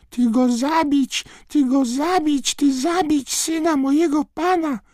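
very high, loud squeaky wail; a frantic little elf screeching accusation; distraught house-elf. A narrator's reading. House-elf Mrużka wailing at Dumbledore over her master, shrieking.

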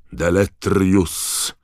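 loud, very slow, drawn-out shouted spell. A narrator's reading. Diggory banishes the ghostly skull, loud shout. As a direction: cast it with a strained shout. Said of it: "krzyknął pan Diggory".

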